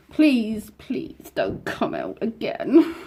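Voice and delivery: slow drawn-out begging; pleading. Real, not acted. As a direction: beg it slowly, stretched out. Begging the spider.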